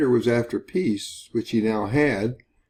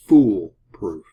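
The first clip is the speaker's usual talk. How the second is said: FOOL punched louder and set apart in "foolproof"; "fool" hit firmly, lower in pitch; stress on FOOL; 4 semitones lower, 7 dB louder than usual. Shown as a demonstration. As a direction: lean hard on "fool" after a short pause. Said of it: "I emphasize the word fool".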